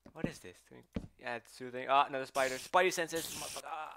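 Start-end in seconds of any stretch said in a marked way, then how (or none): 1.9-4.0 loud, higher startled cry; startled yelp. Real, not acted. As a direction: yelp at the spider. Startled by another spider.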